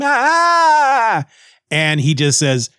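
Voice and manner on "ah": high drawn-out mock scream; long falsetto "aah" scream. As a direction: imitate a distant death scream. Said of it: "we hear a scream".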